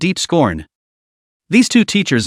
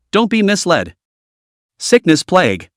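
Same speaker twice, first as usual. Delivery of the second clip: usual speaking voice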